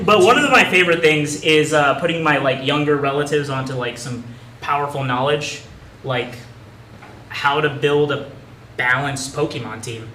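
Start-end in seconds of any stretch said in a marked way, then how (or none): none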